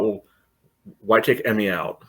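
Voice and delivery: ordinary talk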